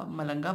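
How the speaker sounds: "मलंगा" starting with an m sound; variant pronunciation. Shown as a demonstration. Vlogger offers another local name, "मलंगा" starting with m. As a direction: say "मलंगा" with m in place of b. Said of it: "बोलते हैं".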